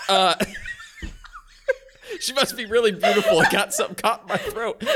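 embarrassed laugh through the words; laughing flustered. Real, not acted. Player flustered by the waitress's flirting, laughing.

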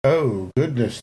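ordinary delivery

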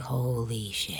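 low, hushed "Holy shit" under the breath; a stunned aside to herself; stunned hushed curse. Retold or imitated. Recognizing Nick at the restaurant, stunned hushed curse. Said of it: "and I'm like".

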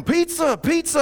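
high, loud shouted instruction; calling out. Retold or imitated. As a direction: yell it across the slope. Instructors call out from slope.

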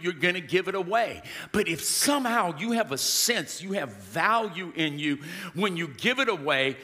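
usual speaking voice

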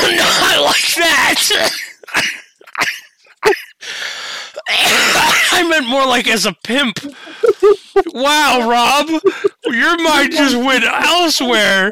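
loud, high laughter through words; cracking up while talking; laughing. Real, not acted. Laughing hard after dark joke.

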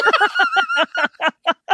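high-pitched burst of laughter; laughing. Real, not acted. Storyteller cracking up after her own yell, high laughing. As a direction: crack up at your own outburst.

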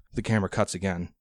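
ordinary delivery

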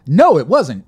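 ordinary talk, nothing marked

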